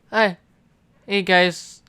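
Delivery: normal talking voice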